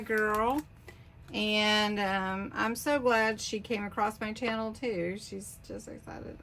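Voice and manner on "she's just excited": whispered aside. Real, not acted. Aside about the sender.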